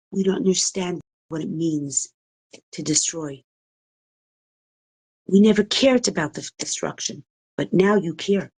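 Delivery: usual speaking voice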